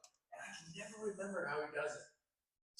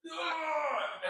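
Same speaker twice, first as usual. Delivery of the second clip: short, higher mock scream; 10 semitones higher, 4 dB louder than usual. Actor retelling his screaming on set, mock scream. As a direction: act out yelling in the scene. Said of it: "I was just screaming".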